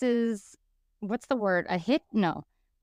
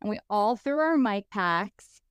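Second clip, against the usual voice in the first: ordinary delivery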